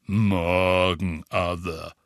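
low, slow graveside voice; sepulchral voice. A narrator's reading. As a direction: speak like a mournful undertaker. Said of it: "sagte er mit Grabesstimme".

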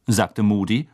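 plain reading voice

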